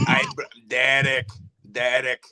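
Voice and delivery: mock Russian accent. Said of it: "big shout out to Mother Russia".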